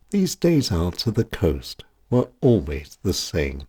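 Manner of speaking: ordinary delivery, nothing marked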